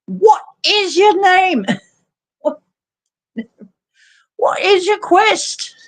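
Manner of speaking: high, loud quest-keeper's challenge; Monty Python. Acted. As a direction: demand it in a silly high British voice. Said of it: "that would be Monty Python".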